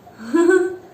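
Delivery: bright happy giggle; light laugh. Real, not acted. Mother laughing with her baby.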